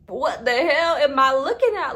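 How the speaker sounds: loud, high outraged shout; shouting what the hell at the screen; shocked yell. Retold or imitated.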